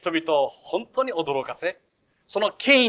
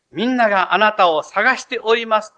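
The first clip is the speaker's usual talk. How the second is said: louder, higher, pressing announcement; urgent report; 6 semitones higher, 9 dB louder than usual. Acted. Disciples urgently telling Jesus everyone is looking, loud. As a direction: blurt it out urgently.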